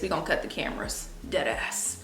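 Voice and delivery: half-whispered aside. Real, not acted. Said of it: "I'm not gonna cry on camera".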